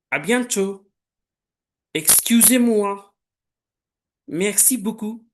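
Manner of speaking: teacher leading a repeat-after-me drill; pronunciation drill. Shown as a demonstration. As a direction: call each word out clearly for pupils to repeat. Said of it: "I'll say a word and you have to repeat".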